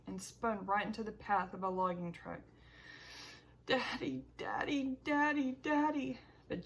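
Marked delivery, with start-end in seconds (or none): 3.7-6.2 higher and rising, each Daddy more urgent; frantic calling. Acted. Boy convinced his father crashed: frantic higher calls. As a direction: call out for him, panic building.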